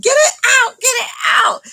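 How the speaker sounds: high, loud panicked yell; frantic high-pitched mock scream; retold screaming. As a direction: re-enact screaming for help. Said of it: "And I start screaming".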